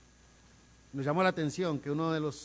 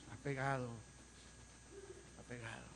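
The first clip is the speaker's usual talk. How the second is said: fading repetition; 2 semitones lower, 14 dB quieter than usual. Acted. Preacher echoing the word to the church, fading. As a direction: let the word sink to almost nothing. Repeated word dies away.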